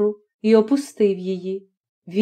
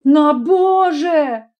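slow drawn-out cry, higher; shouting; 6 semitones higher, much slower than usual. A narrator's reading. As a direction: throw the words out in fury. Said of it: "закричала вона". (протяжний крик, вище)

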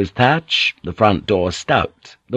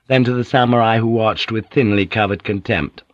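plain reading voice

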